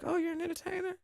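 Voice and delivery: high, softened imitation of an interested doctor; intrigued mock doctor. Doctor reacting to an entertainer patient, high mock intrigue. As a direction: lift it high, playfully quoting her.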